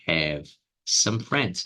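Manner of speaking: very slow "have some friends"; slowed teaching read; slow demo. Shown as a demonstration. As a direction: spell it out slowly for students. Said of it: "eu poderia falar".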